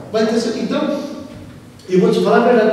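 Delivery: ordinary talk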